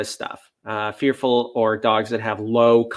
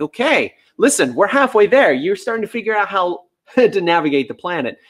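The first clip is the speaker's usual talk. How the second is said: upbeat encouragement; 6 semitones higher than usual. Retold or imitated. Trainer encouraging a dog that offers too many behaviours, upbeat. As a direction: cheer the dog on that it's halfway there.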